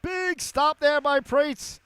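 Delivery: big save call. Real, not acted. Announcer to listeners as the goalie stops a chance, shouting. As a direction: build into a roar for the save.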